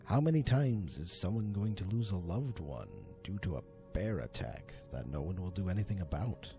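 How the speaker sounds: plain reading voice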